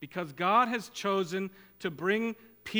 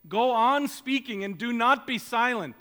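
forceful command; 6 semitones higher, 6 dB louder than usual. Acted. God's command to Paul quoted.

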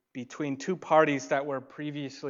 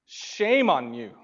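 loud, slow, stern "Shame on you"; scolding rebuke; 3 semitones higher, 8 dB louder, slower than usual. Acted. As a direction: rebuke them hard, leaning on "Shame". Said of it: "should have said from my own sinful perspective".

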